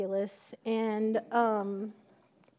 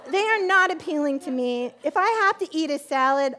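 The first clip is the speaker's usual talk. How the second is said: usual speaking voice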